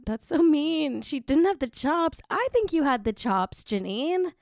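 high, indignant sympathy; indignant outburst. Real, not acted. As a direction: gasp out how unfair it is. Host reacts to Janeane being snubbed.

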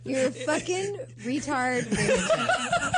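slow, laughing insult. Real, not acted. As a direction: drag it out while laughing.

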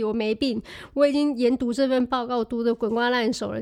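usual speaking voice

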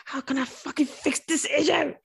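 high, drawn-out mock exasperation; hammed-up angry frustration; vexed. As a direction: act out being vexed, stretching the words. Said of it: "I get angry".

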